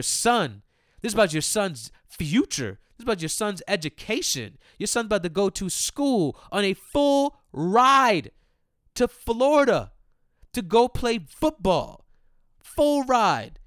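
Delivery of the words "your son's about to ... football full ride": emphatic shouting. Real, not acted. Ranting at a mom who walked off.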